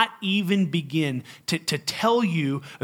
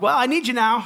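desperate plea; 9 semitones higher, 6 dB louder than usual. Retold or imitated. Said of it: "I'm like".

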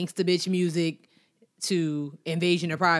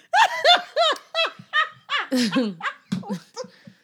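high burst of laughter; 8 dB louder than usual. Real, not acted. Bursts out laughing mid-topic.

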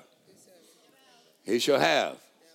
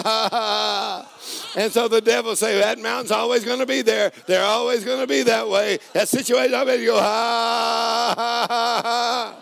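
full-force preaching; 12 semitones higher, 8 dB louder than usual. Acted. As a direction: preach it out like a revival climax.

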